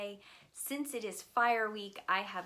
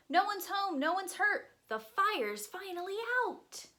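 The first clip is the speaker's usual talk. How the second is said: mild mock shout; 12 semitones higher, 4 dB louder than usual. A narrator's reading. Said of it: "The chief and captain shout".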